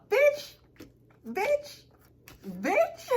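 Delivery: very high, loud squeal; mock outburst. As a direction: squeal each word as a separate jab. Mock outburst at fawning people.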